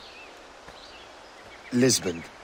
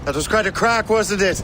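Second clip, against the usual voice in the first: excited shout; 11 semitones higher, 4 dB louder than usual. Acted. After cannon volley in battle.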